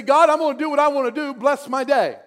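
self-centred prayer. Pretend. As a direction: play the selfish believer telling God the plan. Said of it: "That's one way to pray".

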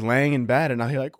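ordinary delivery

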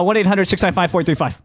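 rattled off quickly; fast number read. Shown as a demonstration. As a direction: speed through the number. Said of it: "maybe you could say it faster".

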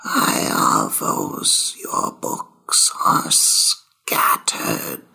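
slow whispered nagging ghost, dropping low at the end; whispering ghost. Acted. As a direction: hiss it like a haunting scold. Ghost calls to Iavos.

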